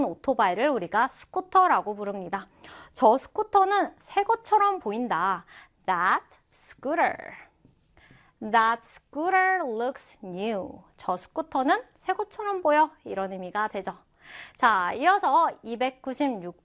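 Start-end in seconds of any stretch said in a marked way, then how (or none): 5.8-10.8 very slow, measured example sentence; slow model reading. Shown as a demonstration. Teacher modelling a scooter example for students, slow model reading. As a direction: model it slowly, pausing for learners.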